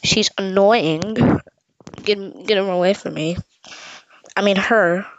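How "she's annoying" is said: ordinary talk, nothing marked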